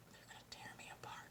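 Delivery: tiny, rushed whisper; anxious whisper. Acted. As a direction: whisper a worried thought very fast. Voicing her own anxious thought.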